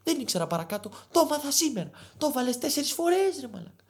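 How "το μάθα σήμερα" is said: usual speaking voice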